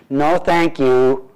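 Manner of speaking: drawn-out, mock-polite no thank you; a polite brush-off, very slow; slow polite refusal. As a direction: stretch each word into a prim refusal. Imagined good-doer refusing compassion.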